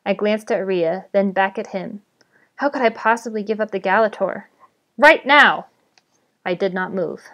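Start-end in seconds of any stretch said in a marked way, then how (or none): 5.0-5.7 raised, louder goblin command; insistent villain's order; sharp order. A narrator's reading. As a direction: demand it at once. Goblin repeats his order.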